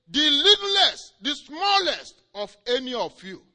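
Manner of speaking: high, loud proclamation, slowing at the end; Jesus proclaiming. Retold or imitated. Jesus telling Hagin about his power, proclaiming. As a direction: declare the authority handed over. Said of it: "Jesus said to him again".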